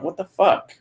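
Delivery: usual speaking voice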